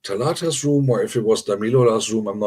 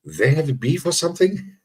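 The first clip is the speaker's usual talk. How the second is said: higher-pitched, rising mock question; puzzled onlookers; 6 semitones higher than usual. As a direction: imitate onlookers wondering, pitch climbing. Voicing puzzled viewers' reaction.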